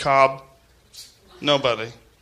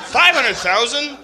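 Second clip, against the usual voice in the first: incredulous; 6 semitones higher, slower than usual. Real, not acted. Incredulous repeat of the number.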